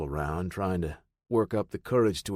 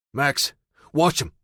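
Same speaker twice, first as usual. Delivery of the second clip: firm command; 5 semitones higher, 5 dB louder than usual. Acted. Commanding the dog.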